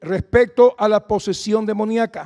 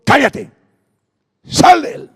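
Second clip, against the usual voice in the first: commanding shout, higher; rebuke; 7 semitones higher, 8 dB louder, slower than usual. Acted. Jesus rebuking the unclean spirit, shouted. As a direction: cast it out with authority. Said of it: "Jesús le reprendió".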